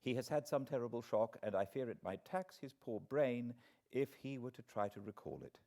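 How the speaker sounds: ordinary delivery, nothing marked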